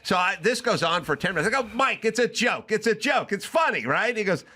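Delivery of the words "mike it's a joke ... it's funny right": high-pitched, pleading retold insistence; exasperated insistence. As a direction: plead the obvious, voice pushed up high. Arguing that it's a joke.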